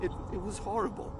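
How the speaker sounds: pained, stammering dismay; shaken recollection. Acted. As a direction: falter as you recall something horrible. Survivor recalling the massacre.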